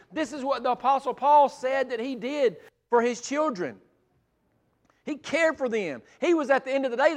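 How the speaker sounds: fervent sermon build; impassioned preaching. Acted. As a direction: preach it with rising passion. Rising into full preaching.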